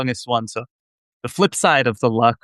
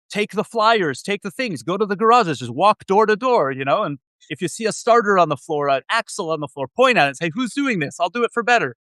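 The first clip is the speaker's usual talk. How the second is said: retold immigrant dad in a much higher, heavy Israeli accent; 7 semitones higher than usual. Son imitating his immigrant dad's pep talk in a heavy Israeli accent. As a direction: mimic your dad's Israeli accent, pitched high. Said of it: "in a heavy Israeli accent".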